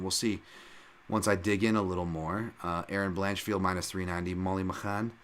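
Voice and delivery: normal talking voice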